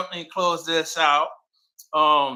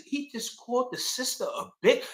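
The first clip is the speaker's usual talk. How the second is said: retelling his own shock, pitched high; incredulous question in a high voice; 10 semitones higher, 3 dB quieter than usual. Retold or imitated. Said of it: "I was shocked".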